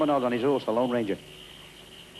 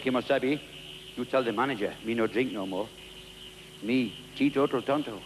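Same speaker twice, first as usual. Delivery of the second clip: Tonto voice; 4 semitones lower, 4 dB quieter than usual. Acted.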